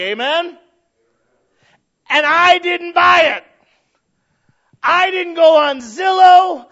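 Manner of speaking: loud, slow, soaring preacher's cry; preaching at full force. Acted. Preacher lifts the congregation toward heaven, shouting slowly. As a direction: build to a roaring, drawn-out climax.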